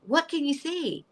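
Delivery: high, wondering question; high-pitched, eager curiosity; eager retold question. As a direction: ask in hushed-excited awe, pitched high. Eagerly asks father about vision.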